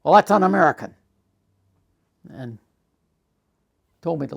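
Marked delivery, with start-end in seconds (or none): 0.0-0.9 louder, higher mock reprimand of the lieutenant; retold scolding. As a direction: bark it like a petty officer. Lieutenant dismissing him.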